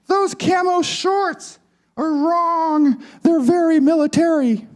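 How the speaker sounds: very high, squeaky doll voice; Barbie's feminine twang. Acted. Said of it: "In her feminine twang".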